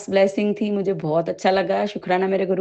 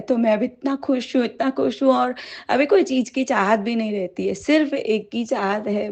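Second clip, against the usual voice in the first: ordinary delivery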